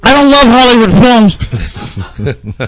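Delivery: loud, high, drawn-out joke shout; mock outburst. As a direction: blast it into the mic for laughs. Told to get closer to mic.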